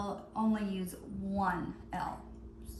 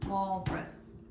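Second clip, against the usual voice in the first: ordinary talk, nothing marked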